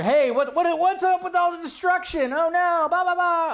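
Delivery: high, loud nasal mock complaint; mocking fans upset about the destruction; mocking whiny fan. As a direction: squeal like an outraged fan.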